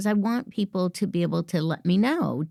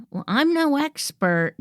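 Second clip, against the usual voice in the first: higher, hesitant "Well, I'm no expert"; hedging self-doubt; 8 semitones higher than usual. Shown as a demonstration. Coach mimics an unsure employee, hesitant and higher. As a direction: sound unsure of yourself. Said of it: "You don't want to say".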